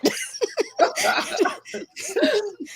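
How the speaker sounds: long high laugh; high laughter. Real, not acted. Cracking up after quoting Step Brothers, high laugh. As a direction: let out a long, high cackle.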